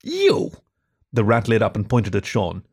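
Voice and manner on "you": very high pointing squeak; a sudden high shout of discovery; excited rat. Acted. Perry points at Sean.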